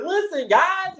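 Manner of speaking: retold giddy squeal; excited squeal. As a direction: squeak it out, too thrilled to hold it. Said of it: "And I'm like".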